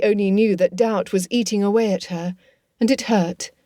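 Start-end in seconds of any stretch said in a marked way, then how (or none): none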